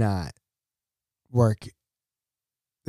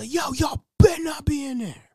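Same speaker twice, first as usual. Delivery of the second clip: high, yelled threat through a door; warning shout. Retold or imitated. Manager yelling through bathroom door.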